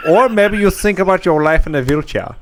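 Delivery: high-pitched German menace; veiled threat in a German accent; hammed-up German interrogator.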